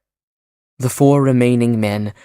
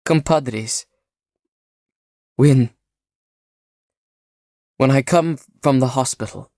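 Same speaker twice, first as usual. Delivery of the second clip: halting, a touch unsteady; injured man faltering as he begs to stay; 3 semitones higher than usual. Acted. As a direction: pause and stumble over the plea. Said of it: "Vamenos faltered".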